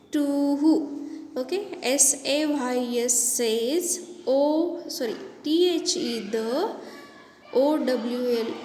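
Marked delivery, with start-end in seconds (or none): none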